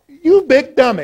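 high, loud "you big dummy" with a fall; lightly voiced Fred Sanford; Sanford and Son quote. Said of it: "as they say from Sanford and Son".

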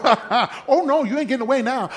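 fast, laughing chase taunt. Retold or imitated. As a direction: gloat while you chase him.

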